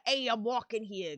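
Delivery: mock New York street accent; broad New York accent. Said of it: "more of a subtle New York accent".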